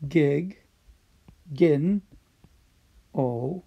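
"Gig, gin, o" split with pauses; teacher sounding out "Gig, gin, o" slowly; syllable by syllable. Shown as a demonstration. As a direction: break "Gig, gin, o" into separate beats. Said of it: "Think about the word".

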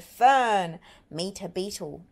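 plain reading voice

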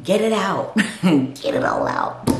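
loud insistent urging; urgent spirit command. Retold or imitated. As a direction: call it out like an inner voice. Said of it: "My spirit man is saying".

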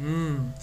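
long "mmm" of pleasure; savoring hum. A narrator's reading. Girl sucks chocolate icing, savoring hum. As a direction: hum it slowly savoring the taste. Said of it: "and went".

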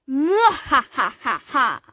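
slow, booming evil cackle; a cartoon villain gloating laugh. A narrator's reading. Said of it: "the evil pea".

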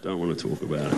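low, soft mock-sulky mumble; quiet sulk. Jesus back home after failing, softly sulking. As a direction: trail it off under your breath. Sulky line repeated, smaller.

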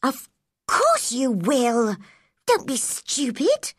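a touch of scolding, drawn out at first; mildly cross. A narrator's reading. Girl dismisses sick cousin's worry, mildly cross. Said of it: "said Mary crossly".

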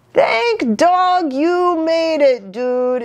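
high, slow exclaiming dog voice; exclaiming friend. A narrator's reading. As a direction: cry it out in relief. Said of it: "exclaimed Don Diego".

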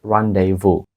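rendezvous said as "RAN-day-voo"; correct pronunciation. Shown as a demonstration. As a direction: leave out the z and s in rendezvous. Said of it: "слово вимовляється як".

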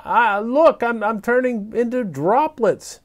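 put-on excited child. Child excited mid-transformation.

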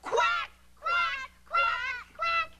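very high squawked quacking; loud duck quacks. Acted. Mother acts out the ducky, high loud quacking. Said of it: "she quacked so loud".

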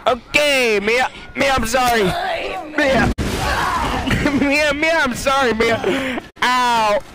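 high frantic pleading; panicked apology. Real, not acted. Player begs the witch Mia, panicked apology. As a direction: beg her forgiveness in a panic.

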